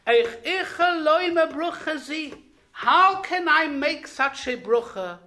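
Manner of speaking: retold loud anguished plea; calling out loudly. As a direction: cry out the question loud and high. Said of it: "he called out in a loud voice".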